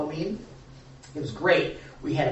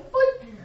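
boing; 4 dB louder than usual. Acted. Playful sound effect word.

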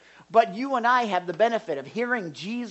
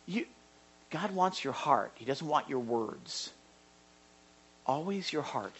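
ordinary talk, nothing marked

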